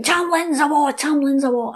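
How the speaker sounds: high shout of the fairy crowd. Acted. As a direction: cry it out high like the fairy host raising the alarm. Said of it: "everybody's shouting".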